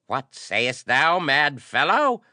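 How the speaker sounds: high, loud screech of an arch-priest; outraged screech. A narrator's reading. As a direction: screech the question in disbelief. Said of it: "screeched the arch-priest".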